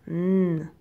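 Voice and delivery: "N" as a long nnn hum, not the letter name; isolated sound. Shown as a demonstration. Teacher counting sounds for pupils, held "N". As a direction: sustain the consonant "N" alone. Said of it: "On entend".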